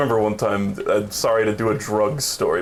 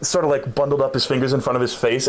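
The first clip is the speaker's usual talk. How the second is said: usual speaking voice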